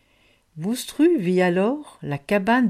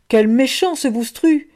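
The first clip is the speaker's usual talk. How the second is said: higher, louder complaint; indignant child; 5 semitones higher, 6 dB louder than usual. A narrator's reading. Child complaining to the others about Boustru, indignant. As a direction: protest like a wronged child.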